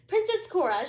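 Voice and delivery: high, loud stern call of the name; a queen starting a telling-off; sharp, reproachful summons; scolding queen. Acted. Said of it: "she began".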